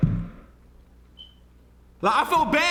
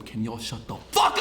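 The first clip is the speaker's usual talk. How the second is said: mock annoyed; 4 semitones lower than usual. Real, not acted. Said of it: "Where your lip gloss from?".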